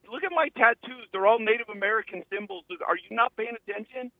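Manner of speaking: indignant retort. Retold or imitated. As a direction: act out his affronted insistence. Retold indignant retort to accuser.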